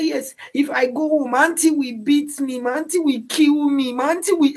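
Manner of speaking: put-on high, pleading child's voice; quoting a crying little boy in a high voice; frightened little boy. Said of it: "He said".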